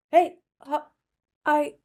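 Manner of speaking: high, loud coffee-shop hellos; overfriendly mom greeting. Retold or imitated. Mom greeting a room of strangers, high and overfriendly. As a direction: call out to strangers as if you know them.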